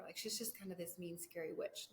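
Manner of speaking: ordinary talk, nothing marked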